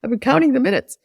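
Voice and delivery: high, incredulous yelp; a wife balking at her husband's request for time; mock outrage. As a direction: balk at the half hour in disbelief. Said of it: "I was like".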